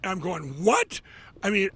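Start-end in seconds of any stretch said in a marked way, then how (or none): 0.6-1.0 sudden high loud disbelieving what; stunned what. Retold or imitated. Hearing the lawyer say they won, loud shocked what. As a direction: jump up in shock at the news. Retold hearing the appeal win.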